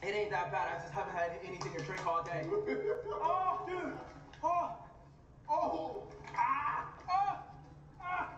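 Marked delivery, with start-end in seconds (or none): 2.5-8.3 high pained gasps; panting through chili heat; mouth burning from spicy food. Real, not acted. Burning after swallowing chip.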